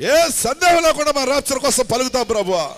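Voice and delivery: loud, high, impassioned intercession; fervent prayer. Acted. Pastor praying over the live audience, loud and fervent. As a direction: cry out to God with full force.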